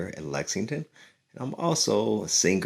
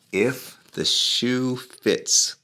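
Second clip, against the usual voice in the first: slow, drawn-out title announcement; dramatic title read; 6 semitones higher, 4 dB louder, much slower than usual. Acted. Reader announcing the book title to children, slow and grand. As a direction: present the book title slowly.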